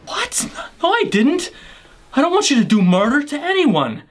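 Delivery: high, startled denial; shocked. Acted. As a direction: yelp in disbelief.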